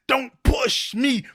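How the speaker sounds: quoting the song like you're at your limit; rising to a near-yell on edge; frustrated shout. Retold or imitated. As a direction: belt the old-school lyric out, high and loud. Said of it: "we're like".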